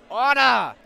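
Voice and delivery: loud high bark with a falling end; judge shouting order. Acted. Judge silencing the court, loud and high. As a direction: slam it down like a gavel.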